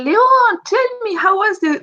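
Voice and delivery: put-on high, bright call to an old friend; excited greeting. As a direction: greet him with delight, voice lifted. Greeting Leon in the street.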